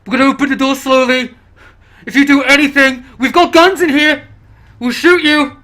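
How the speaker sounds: loud, high, tense threat, rising; forceful armed survivor calling out; shouted warning. Acted. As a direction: lay down terms through a closed door. Armed survivor threatens through door.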